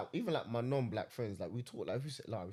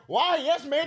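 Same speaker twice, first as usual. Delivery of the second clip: high, loud, laughing mock reply; 12 semitones higher, 7 dB louder, slower than usual. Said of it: "So I was like".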